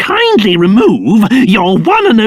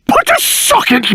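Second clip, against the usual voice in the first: ordinary talk, nothing marked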